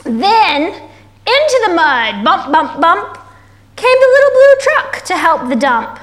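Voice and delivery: animated, swooping picture-book narration; story reading to children. A narrator's reading. Reading the little truck's rescue to kids, animated storytelling. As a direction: draw out the suspense, lifting key words high.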